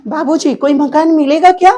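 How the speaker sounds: a hint of a timid tenant; lightly voiced tenant; tenant asking. Shown as a demonstration. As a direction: ask the landlord humbly for a room. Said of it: "देखिए क्या अभिनय".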